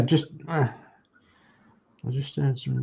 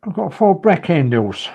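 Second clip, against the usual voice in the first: Essex accent; 6 semitones higher, 9 dB louder than usual. Shown as a demonstration. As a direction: say it like a proud Essex local. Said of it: "Essex accent".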